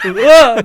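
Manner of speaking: loud, high yelp through laughter; comic yell. Retold or imitated. Surfer falling off his board, comic startled yell. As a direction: yelp as if toppling, half laughing.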